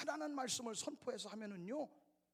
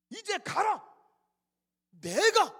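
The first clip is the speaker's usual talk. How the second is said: higher and much louder, a booming order; commanding shout; 9 semitones higher, 14 dB louder, much slower than usual. Acted. God loudly ordering Moses to go. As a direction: command him to go with full force.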